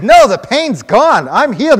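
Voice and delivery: high, yelled protest; emphatic insistence. Retold or imitated. Healed man arguing with his doubter, emphatic shouted insistence. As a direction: shout it back to convince them.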